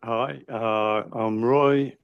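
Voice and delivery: ordinary talk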